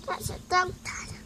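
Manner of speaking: soft unvoiced whisper. Real, not acted. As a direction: whisper it to yourself. Toddler whispers word.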